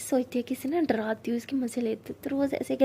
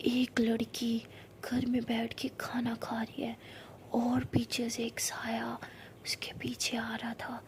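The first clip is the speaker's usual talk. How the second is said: creepy ghost story whisper; 5 dB quieter, slower than usual. Acted. Rose builds ghost story tension.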